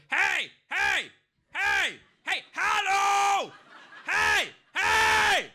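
high, repeated, indignant calls; escalating high-pitched German hollering; passive-aggressive German anger. Retold or imitated. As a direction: scold from afar in a shrieky German voice. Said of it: "if a German person gets mad at you".

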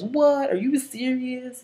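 high-pitched mock disbelief; Trent. Trent hearing about Blaine's eye, high mock shock. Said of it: "And then Trent goes".

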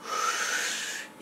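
long breathy sigh out; demo sigh. Shown as a demonstration. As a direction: let out a slow sigh. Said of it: "like a sigh".

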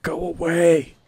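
panicked shoo; shooing shout. Real, not acted. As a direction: yell it at the creature. Shooing a creature away.

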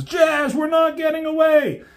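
high, loud cry of doom; high panicked cartoon voice; desperate shout. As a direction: scream that the shuttle can't escape. Shuttle pulled into Unicron.

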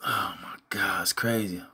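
whispered, low, barely there; stunned whisper. Real, not acted. Vlogger whispering in shock at the footage. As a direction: breathe it out in disbelief.

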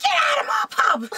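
high, loud Cockney landlady screech; hammed-up Cockney yell; Cockney shout. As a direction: bellow them out in Cockney. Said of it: "that's official Cockney".

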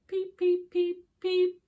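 high, loud chirping; baby bird peeps. A narrator's reading. Storyteller peeping as the fourth baby bird. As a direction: chirp eagerly like a chick. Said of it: "said baby bird number four".

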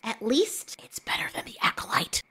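a conspiratorial whisper. Retold or imitated. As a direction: breathe it like a secret aside. Confides what she kept saying.

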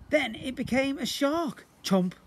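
usual speaking voice

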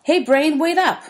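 high, loud call from behind. A narrator's reading. Bully calls to the kids walking home, loud and high. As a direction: shout after them down the street. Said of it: "A voice behind us calls".

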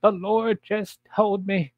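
high, slow churchy lady voice; high mock church lady; mock prophetess. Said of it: "one fat prophetess gets up".

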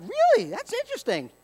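high, exaggerated fake surprise; mock surprise. Preacher mimicking his own feigned amazement at coworker, high mock surprise. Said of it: "I'm like".

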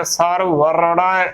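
ਸਰ ਵਰੜਾ with v and an open "ai" ending; correct pronunciation. Shown as a demonstration. Teacher correcting the congregation, careful pronunciation. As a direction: say ਸਰ ਵਰੜਾ slowly as "sar-var-rai". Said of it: "ਉਚਾਰਨ ਕਰਨਾ ਹੈ".

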